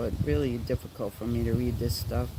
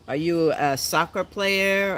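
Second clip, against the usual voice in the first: ordinary delivery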